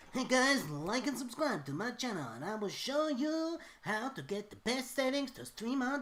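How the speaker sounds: high, hyped YouTube tutorial host; YouTuber voice. Retold or imitated. Mocking YouTube tutorial hosts, high hyped voice. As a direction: pitch it up like an overexcited streamer. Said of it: "people being like".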